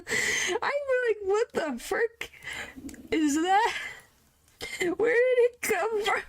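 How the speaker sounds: high, stretched squeal; squealing disbelief. Real, not acted. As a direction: shriek it in baffled shock. Shocked by new translation.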